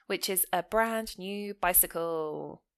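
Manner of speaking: ordinary talk, nothing marked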